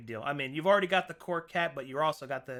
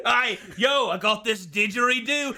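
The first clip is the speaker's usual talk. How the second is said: hammed-up Aussie shout; 7 semitones higher, 5 dB louder than usual.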